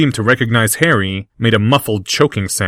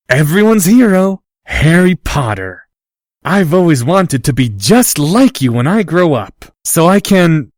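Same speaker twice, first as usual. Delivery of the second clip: mock fan gushing; 6 semitones higher, 4 dB louder than usual. Harry teasing Draco, mock-adoring and loud. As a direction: ham up the hero worship.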